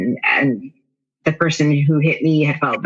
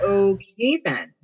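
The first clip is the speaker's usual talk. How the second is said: high, slow, drawn-out reaction; mildly comic stunned acceptance; mock taken aback; 5 semitones higher, 3 dB quieter, slower than usual. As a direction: stretch it out, pitched up, as if just told off. Retelling stunned reaction to tough love.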